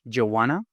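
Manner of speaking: "Do you want to" squeezed into "d'you wanna"; casual run-together "d'you wanna"; reduced form. Shown as a demonstration. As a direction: blend it into "d'ya wanna", rising.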